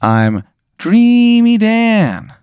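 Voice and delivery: high, very slow, eerie clown voice; Dreamy Dan. Acted. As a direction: stretch each word out like a creepy dream clown. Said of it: "he goes, "Hello, Ashley. I'm Dreamy Dan".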